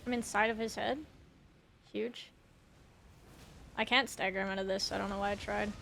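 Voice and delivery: ordinary delivery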